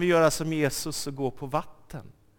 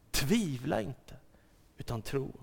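softer, gentle Tvivla inte, utan tro; soft repeat; 2 semitones lower, 7 dB quieter than usual. Shown as a demonstration. Preacher repeats it softly to the congregation. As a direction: say it again quietly to the listener.

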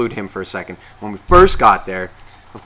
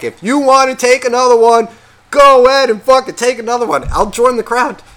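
loud, higher fed-up yell; exasperated yell; 11 semitones higher, 7 dB louder than usual. Retold or imitated. Giving in to the groom, loud exasperated yell. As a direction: throw up your hands and yell over the music.

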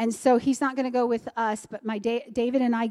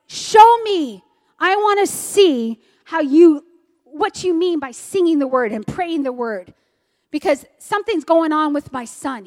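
loud, high, imitated challenge; retold forceful demand; 4 semitones higher, 8 dB louder than usual. Pastor challenging the teacher to show him, loud retold demand. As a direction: demand proof as the worked-up father.

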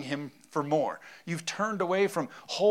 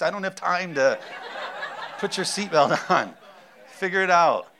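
exasperated laughing bark; 3 semitones higher, 6 dB louder than usual. Retold or imitated. Exasperated parent in car.

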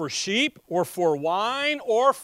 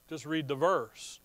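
ordinary delivery

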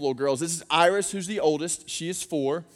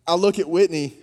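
usual speaking voice

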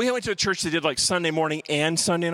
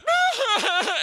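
high whimpering wail, played for laughs; mock crying wail; 14 semitones higher than usual. Boy hit by darts, high mock whimpering wail. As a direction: wail like a scared kid. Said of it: "I was instantly crying like a baby".

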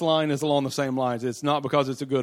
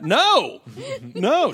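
emphatic no; 12 semitones higher, 6 dB louder than usual. Real, not acted. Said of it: "she's so dismissive".

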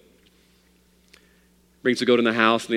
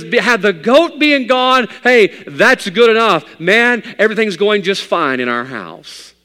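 loud, much higher, bursting with joy; excited; 9 semitones higher, 9 dB louder than usual. Acted. Father of nine to his preacher, overjoyed. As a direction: gush it out, delighted. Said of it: "so excited, so happy".

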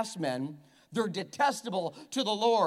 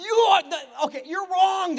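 mock scolding; 9 semitones higher, 8 dB louder than usual.

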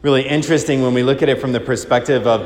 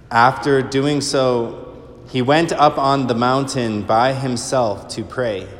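slow, measured liturgical reading; solemn Gospel reading. Acted. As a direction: proclaim it steadily from the ambo.

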